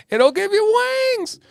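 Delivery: high squeaky ad voice; mock slogan. Joking to chat in a high mock ad voice. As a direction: pitch it way up like a silly ad. Slogan parody after laugh.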